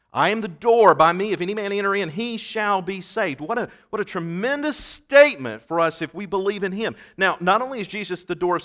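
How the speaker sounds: verse proclaimed high and loud; forceful preaching. Real, not acted.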